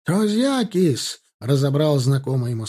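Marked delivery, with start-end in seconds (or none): 0.0-1.2 high, stretched-out cry; lifted, drawn-out shout; yelled alarm. A narrator's reading. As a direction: yell the word out in alarm, slowly. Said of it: "Сидящие в них обозные орали".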